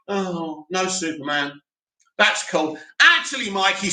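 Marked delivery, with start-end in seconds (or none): 0.1-2.8 soft, low, deflated acted reaction; mock letdown. Fan reacting to news of another Henry project, mock letdown. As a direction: imitate swallowing disappointment, quiet and low. Retold muted reaction to no Superman.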